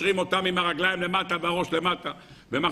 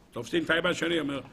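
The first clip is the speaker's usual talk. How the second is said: ordinary talk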